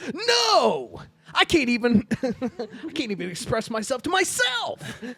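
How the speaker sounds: high, exploding comic refusal; hammed-up shout of self-frustration; mock yelling. Sketch character rejecting an offer of help, mock yelling. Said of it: "Why are you yelling at me?".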